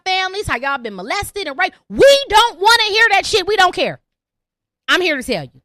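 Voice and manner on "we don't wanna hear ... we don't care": louder, flat-out dismissal; blunt outburst. Real, not acted. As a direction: shut them down hard.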